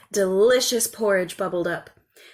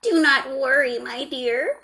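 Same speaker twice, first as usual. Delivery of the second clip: put-on higher, kindly old woman's voice; 6 semitones higher, 4 dB louder than usual. A narrator's reading. Old woman comforting the crying girl, kindly. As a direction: soothe the girl as a kind old crone. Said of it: "said the old woman".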